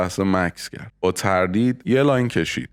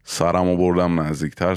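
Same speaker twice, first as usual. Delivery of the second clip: usual speaking voice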